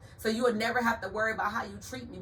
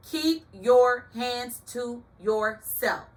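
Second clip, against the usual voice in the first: drawn out and louder, every word laid down; slow loud warning; 4 semitones higher, 7 dB louder, much slower than usual. Shown as a demonstration. To a pushy date, a slow loud warning. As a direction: warn him off slowly and loudly. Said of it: "repeated warning, building".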